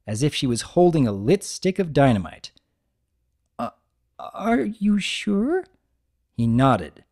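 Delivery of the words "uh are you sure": slow, high, hesitant question; timid fear. Acted. Terrified Fluttershy checking with Big Mac, slow and hesitant. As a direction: barely dare to ask. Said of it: "with abject terror".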